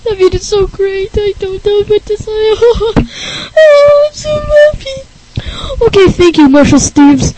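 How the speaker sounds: high, loud hammed-up tearful gushing; fake-sob with happiness; mock crying joy. Rick thanking Marshall, hammed-up teary and squeaky. [name] overjoyed to be invited.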